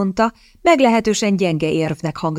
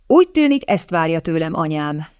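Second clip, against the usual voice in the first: ordinary delivery, nothing marked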